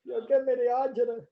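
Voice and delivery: high, loud, hammed-up angry grumble; exaggerated old Italian grandpa. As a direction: playfully ham up the exasperated grandpa. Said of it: "when he was angry, he says".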